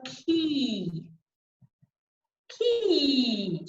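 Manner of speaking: long "ki" with a falling glide; high-tongue vowel. Shown as a demonstration. Coach showing "ki" after placing the tongue, long and pure. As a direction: draw out "ki" with tongue high, tip down. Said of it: "tip of the tongue there".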